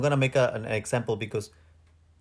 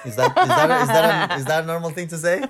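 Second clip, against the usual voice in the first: asking through real laughter, much higher and louder; laughing question; 6 semitones higher, 8 dB louder than usual. Real, not acted. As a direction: giggle your way through the question. Laughing at her own mistake.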